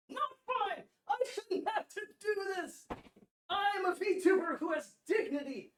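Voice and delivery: high frantic laughing protest; laughing outburst. Real, not acted. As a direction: shout it while cracking up.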